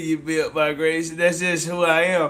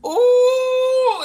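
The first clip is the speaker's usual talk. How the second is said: excited high ooh; 4 dB louder than usual. Real, not acted. Excited reaction on stream.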